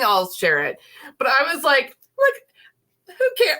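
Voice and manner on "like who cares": high squeaky giggling voice; laughing. Real, not acted. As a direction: laugh it out, embarrassed and giddy. Giddy embarrassed about contest entry.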